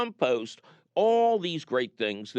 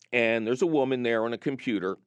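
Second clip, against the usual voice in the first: ordinary talk, nothing marked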